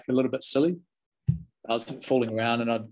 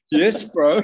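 loud insistence; 6 semitones higher, 9 dB louder than usual. Retold or imitated. Sound man confirms the gossip.

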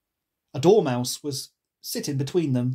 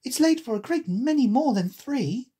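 put-on higher-pitched girl voice; storybook heroine, pitched up; Alice; 9 semitones higher than usual. A narrator's reading. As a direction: object in a raised girl voice. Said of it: "said Alice".

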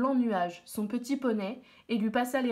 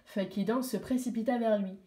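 ordinary delivery

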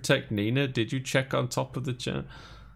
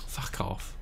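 whispered curse; 10 dB quieter than usual. Real, not acted. Startled by a game scare.

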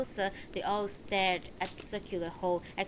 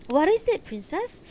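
lightly voiced husband, raised pitch and louder; concerned husband; 11 semitones higher, 7 dB louder than usual. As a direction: ask your wife what's wrong, high and urgent. Husband reacts to wife's cry.

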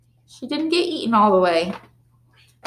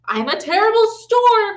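high, loud storm yell; Sammy pretends to be a storm in class; 11 semitones higher, 4 dB louder than usual. Shark yelling during dance. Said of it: "Sammy yelled".

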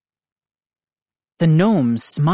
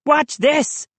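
put-on bully kid voice; 12 semitones higher than usual. A narrator's reading. Moose shows off.

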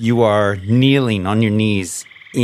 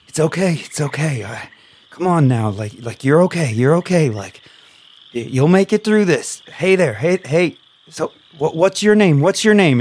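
reassuring captive; 6 semitones higher than usual. Acted. GM voices captive Michael.

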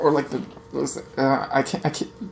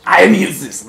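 loud mock outburst; 4 semitones higher, 8 dB louder than usual. Real, not acted. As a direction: exclaim it loudly. Self-mocking outburst.

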